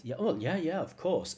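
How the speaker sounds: high, bright echo of her yeah of course; echoing her natural yes. Retold or imitated. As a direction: repeat her eager reply. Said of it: "this is what I want more of".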